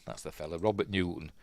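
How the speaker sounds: ordinary delivery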